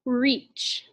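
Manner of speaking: "reach" whispered with a long ee; whispered word. Shown as a demonstration. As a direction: breathe out "reach", long ee vowel. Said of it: "first word".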